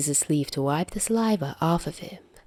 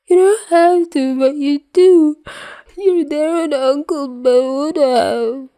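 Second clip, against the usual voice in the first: tearful wail; 10 semitones higher, 5 dB louder than usual. Acted. Peter crying about Uncle Ben.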